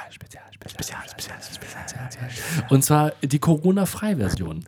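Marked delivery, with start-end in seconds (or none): 0.1-1.6 echo effect. Pretend. Mock echo after the show name.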